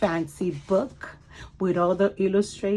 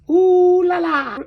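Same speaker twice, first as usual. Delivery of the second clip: high, loud delighted ooh la la; 12 semitones higher, 15 dB louder, slower than usual. Acted. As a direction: gush like a fancy lady. Family dressed up posh.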